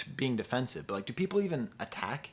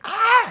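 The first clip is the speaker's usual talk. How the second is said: sharp high yelped ah; mock ad-lib yell; 10 dB louder than usual. Reviewer imitates a yelp from the track, high and loud. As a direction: bark out a sudden high ah. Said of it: "there's even just parts where it's like".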